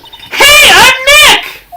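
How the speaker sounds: loud high yell; shouting. Pretend. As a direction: shout it at the mic. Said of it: "not saying it loud enough".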